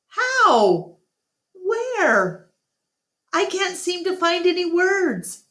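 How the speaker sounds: high, breathless disbelief; grasping for words in shock; stunned. A narrator's reading. Ivan, stunned at Bob's arrival, stammers high.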